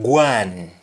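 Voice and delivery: "guan" said GWAAN; "guan" as one syllable with gw; Jamaican pronunciation. Shown as a demonstration. Teacher showing going in Jamaican, "guan" as gwaan. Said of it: "words like going becoming guan".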